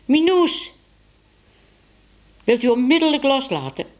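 high-pitched, indignant order; stern reprimand. A narrator's reading. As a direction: scold sharply, name first, then a quick command. Tibbe orders Minoes to let go.

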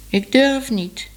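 high, slow plaintive whine; complaining. A narrator's reading. Scared lady in the tree complains to Tibbe, whining. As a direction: plead that it is too scary. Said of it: "klaagde de juffrouw".

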